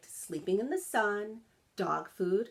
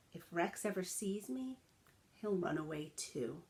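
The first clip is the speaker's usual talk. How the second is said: much softer, a scared private worry; worried inner thought; 8 dB quieter than usual. A narrator's reading. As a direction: say it to yourself, afraid of being seen.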